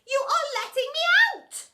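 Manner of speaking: high, loud joyful cry; exclaiming. A narrator's reading. Boy thinks teacher is freeing him, relieved exclamation. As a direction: squeal it with relief. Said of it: "exclaimed Roland".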